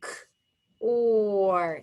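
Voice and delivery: short "k", pause, long "or"; segmented phonics demo of "k, or"; sounded out. Shown as a demonstration. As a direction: sound out K and OR separately while writing. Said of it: "Let's write it".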